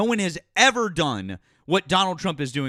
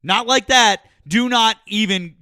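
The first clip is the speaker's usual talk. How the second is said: loud, higher, emphatic refusal; indignant objection; 4 semitones higher, 6 dB louder than usual. Real, not acted. Debater rejecting the caller's slavery comparison, indignant. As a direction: cut them off, indignant and loud.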